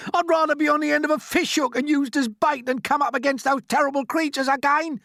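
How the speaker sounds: high, loud frightened rant; desperate to escape; terrified. A narrator's reading. Earthworm dreading the cloud men, terrified. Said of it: "shrieked the poor blind earthworm".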